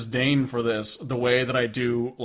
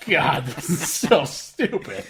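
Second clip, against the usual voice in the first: laughing; 3 semitones higher, slower than usual. Retold or imitated. Laughing at the song lyrics.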